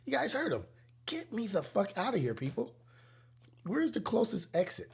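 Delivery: high panicked plea. Retold or imitated. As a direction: beg the room to get you out. Calling out to escape.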